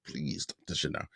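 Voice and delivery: low, hushed aside; muttered plea. Retold or imitated. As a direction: drop right down and plead under your breath. His muttered aside answering the chairman.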